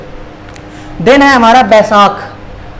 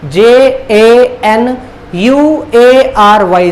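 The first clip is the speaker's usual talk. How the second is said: slow, letter by letter; spelling out; much slower than usual. Shown as a demonstration. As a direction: spell it out slowly with a gap after each letter. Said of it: "spelling होता है इसका". (एक-एक अक्षर धीरे-धीरे)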